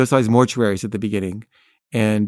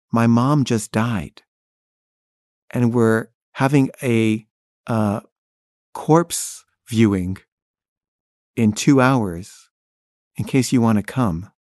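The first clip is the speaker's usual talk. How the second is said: slow, heavy phrasing with long pauses; slow grieving news; slower than usual. Retold or imitated. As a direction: break the news gently, word by word. Friend reports her mom's death.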